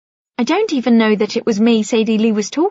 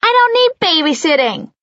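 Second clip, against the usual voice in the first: put-on high, loud little girl protest; child yelling; 9 semitones higher, 4 dB louder than usual. A narrator's reading. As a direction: yell it like an indignant child. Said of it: "Bella yells".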